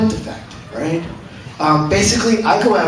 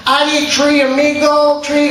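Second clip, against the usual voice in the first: mock pidgin, higher than usual; 7 semitones higher than usual. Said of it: "he's trying his Spanish".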